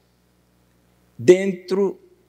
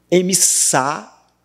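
"emissá" slow and higher, landing on "sá"; stress on last counted syllable; 4 semitones higher, much slower than usual. Shown as a demonstration. Teacher showing the last counted syllable, stressed "sá" in "emissá". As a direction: cut "emissá" off at its stressed final "sá". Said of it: "é a última sílaba tônica".

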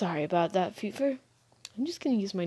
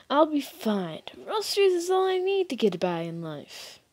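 higher-pitched, dismissive student voice; defiant student; 7 semitones higher than usual. Acted. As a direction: brush off the lecture, pitched up.

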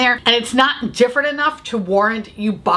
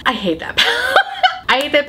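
laughing through words; 5 semitones higher than usual. Real, not acted. Laughing while ranting.

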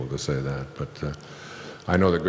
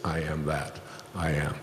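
quieter, dropped restatement; softer throwaway "I am that I am"; soft spoken aside; 7 dB quieter than usual. Shown as a demonstration. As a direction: murmur it back as a reflection. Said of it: "meaning everything that exists".

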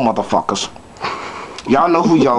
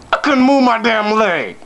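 usual speaking voice

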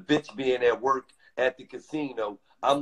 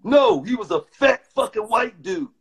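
higher-pitched indignant rebuttal; heated correction; 7 semitones higher, 4 dB louder than usual. Real, not acted. Man correcting friend about the guard, heated. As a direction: cut in to set her straight, worked up.

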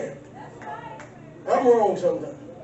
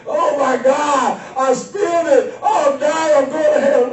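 mock panic; 4 semitones higher, slower than usual. Churchgoer who spilled the communion, mock panicked yelling.

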